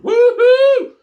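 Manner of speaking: high, loud whoop; relieved whoop to a mate; cheering woo. Retold or imitated. Relieved cheer after the trip.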